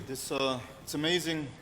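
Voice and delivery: ordinary delivery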